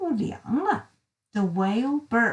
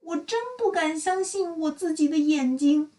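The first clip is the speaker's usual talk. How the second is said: amazed declaration; 5 semitones higher than usual. Acted. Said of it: "贪婪先生大声宣告".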